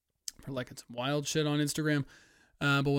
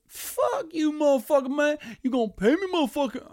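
high-pitched, put-on cussing out; loud, hammed-up outraged reply; 13 semitones higher, 4 dB louder than usual. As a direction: fire back indignantly in a high voice. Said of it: "I don't know why I'm sounding so dark".

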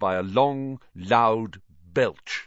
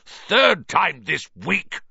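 high, loud, slow roar; angry roar; 11 semitones higher, 6 dB louder, slower than usual. A narrator's reading. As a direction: roar it across the table. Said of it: "he roared across the table".

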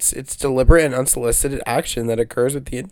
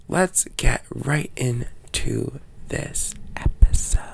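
whispering; slower than usual. Acted. Whispered show intro.

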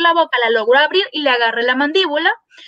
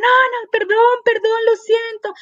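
high, anxious apology; 8 semitones higher than usual. Retold or imitated.

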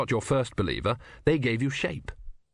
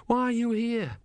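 put-on high, earnest young novice; higher, innocent young monk voice; young Brutha voice; 11 semitones higher than usual. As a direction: ask it wide-eyed and high. Brutha speaks.

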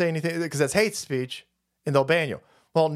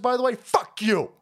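ordinary talk

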